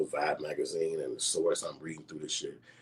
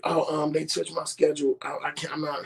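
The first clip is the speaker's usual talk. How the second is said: flustered retelling; 12 semitones higher than usual. Retold or imitated. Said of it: "I'm like".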